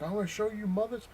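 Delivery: high-pitched riled-up imitation; hammed-up vow of revenge; mock angry threat. Rival flips gun to full auto, mock angry threat.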